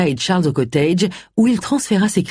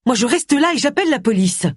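renvoi fâché, voix aiguë; tempête dans l'aigu, sans forcer le volume; tempêtant; 8 semitones higher than usual. A narrator's reading. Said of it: "tempêta Allison". (colère haut perchée)

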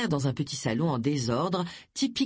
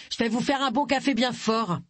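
higher raised call up the stairs; cheerful raised call after Agatha; calling after her; 8 semitones higher than usual. A narrator's reading. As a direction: call out to someone walking away. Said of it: "lui lança Doris".

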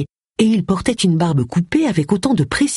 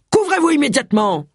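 high-pitched indignant command; outraged order; 10 semitones higher than usual. A narrator's reading. Inspector ordering a naked man to cover up, outraged. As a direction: order it in outrage, volume held back.

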